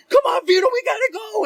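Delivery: high, loud frantic shout; panicked urging. Retold or imitated. Passenger to friend fumbling keys, retold panicked shout. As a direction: yell to hurry the driver along.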